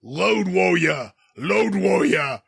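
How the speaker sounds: slow, drawn-out "Load Warrior" with an L for the R and no final R; imitating a thick mock-Russian "Load Warrior"; mock Nikita accent. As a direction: mouth gaping "Load Warrior", spoken slowly. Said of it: "the way he says Road Warriors like".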